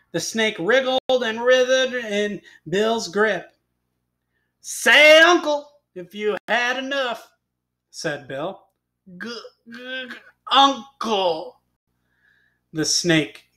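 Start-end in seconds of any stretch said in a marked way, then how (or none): 4.6-7.3 loud, high, bossy demand; commanding cowboy. A narrator's reading. Cowboy demands snake's surrender. 9.2-11.6 slow, choked stammer of a squeezed snake; gurgling snake. A narrator's reading. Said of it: "gurgling out the sound as best he could".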